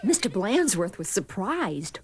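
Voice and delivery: ordinary delivery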